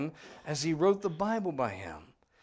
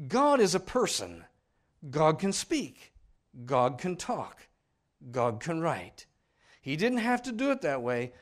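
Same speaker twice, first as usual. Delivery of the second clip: forceful preaching; 5 dB louder than usual. Acted. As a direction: preach it from the pulpit.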